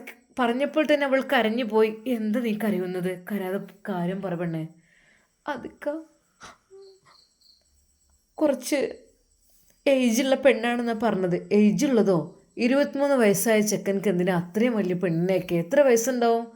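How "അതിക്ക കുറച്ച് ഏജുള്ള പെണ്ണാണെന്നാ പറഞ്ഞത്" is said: tearful wife. Acted. Wife voiced, crying.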